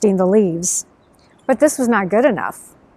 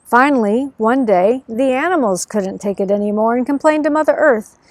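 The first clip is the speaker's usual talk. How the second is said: ordinary delivery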